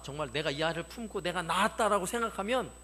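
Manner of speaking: ordinary talk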